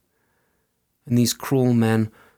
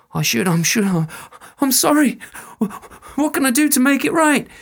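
much higher, louder, fast panicked pleading; panicked soldier; 13 semitones higher, 3 dB louder than usual. Acted. Soldier pleads with the fairy woman, frantic and high. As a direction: babble out excuses in fear.

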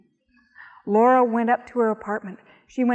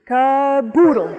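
louder, slow call; magic word called out; 2 semitones higher, 6 dB louder, slower than usual. A narrator's reading.

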